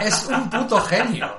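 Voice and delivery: amused laughing admiration. Real, not acted. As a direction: laugh through it. Praising the YouTuber.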